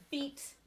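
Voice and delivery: "beat" with one long E vowel. Shown as a demonstration. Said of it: "it's pronounced as a long E".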